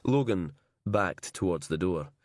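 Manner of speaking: usual speaking voice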